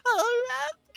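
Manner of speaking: put-on high, trembling swooning girl; trembling cry. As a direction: cry out his name, swooning. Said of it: "cried Helga in trembling voice".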